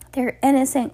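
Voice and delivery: high-pitched outcry; crying out. A narrator's reading. Hal defends the children, high cry. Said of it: "cried Hal".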